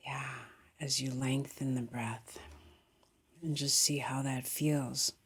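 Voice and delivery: calm meditation guide, long pauses between phrases; guided meditation. Real, not acted. Guiding meditators to feel into the body, calm meditation voice. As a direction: ease them gently into breath and body, unhurried.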